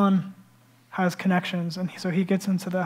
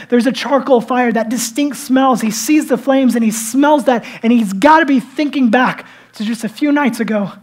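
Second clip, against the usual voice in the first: loud, raised preacher voice; impassioned preaching; 5 semitones higher, 10 dB louder than usual. Acted. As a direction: drive the story home with full force. Preaching the charcoal fire at full force.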